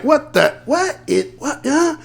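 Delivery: high, startled "what?" in a put-on voice; mock gawking surprise. Crowd gawking at a voluptuous woman, mock surprise. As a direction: play the stunned onlookers, half joking. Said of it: "they were just like".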